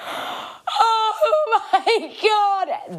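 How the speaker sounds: gasping, drawn-out Oh my God, high and slow; breathless, hammed-up gasp of disbelief; shocked gasp. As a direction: stretch it out in mock shock.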